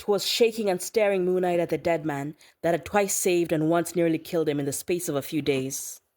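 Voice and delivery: ordinary delivery, nothing marked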